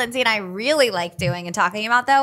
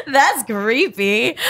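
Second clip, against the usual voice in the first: real laugh through the words, slow; 6 semitones higher, 3 dB louder, much slower than usual. Real, not acted. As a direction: laugh it out while saying it. Laughing at a creepy comparison.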